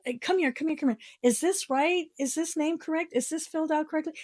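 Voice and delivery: high, fretful calling over; mock a fussing clerk summoning help; panicked clerk. Mocking clerks fussing over form.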